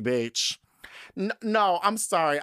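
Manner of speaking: normal talking voice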